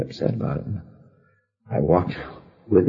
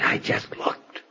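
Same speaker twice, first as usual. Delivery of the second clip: slow deadpan whisper; 8 dB quieter, much slower than usual. Retold or imitated. Answering a friend at a funeral, whispering. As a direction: whisper it, unbothered.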